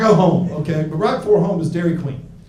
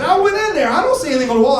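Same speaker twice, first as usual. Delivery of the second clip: higher, mocking voice for the booth guy; 10 semitones higher, much faster than usual. Comic mimics porn booth worker, higher pitch. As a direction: lift the pitch to mock him. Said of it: "He said".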